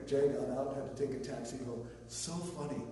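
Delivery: ordinary talk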